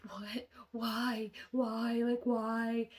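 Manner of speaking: high, exasperated wail of why; distressed pleading. Retold or imitated. Girl hearing his dying threat, distraught pleading.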